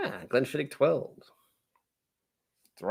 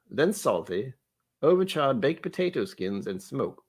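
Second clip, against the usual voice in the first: measured English butler reading tasting notes; English butler accent. Acted. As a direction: read it out in a put-on English accent. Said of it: "some kind of English butler".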